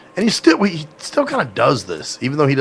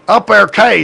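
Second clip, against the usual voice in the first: loud, high, run-together country accent; thick southern accent; 7 semitones higher, 6 dB louder than usual. Shown as a demonstration. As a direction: blurt "Up there, okay" as one Southern word. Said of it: "sounds like some kind of fucking dental disorder".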